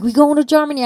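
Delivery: mock astonished friend; surprised.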